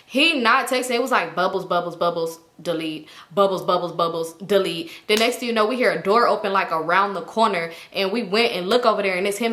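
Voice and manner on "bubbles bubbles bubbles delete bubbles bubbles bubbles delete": typing bubbles imitation. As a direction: mimic someone typing then deleting.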